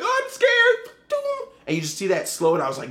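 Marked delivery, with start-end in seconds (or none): 0.0-1.0 much higher, squeaky fake-frightened voice; mock the archer as a scaredy-cat; mock scared archer. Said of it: "one of the dudes with a bow and arrow is like".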